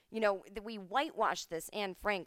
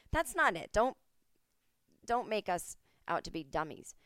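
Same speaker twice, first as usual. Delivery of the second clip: normal talking voice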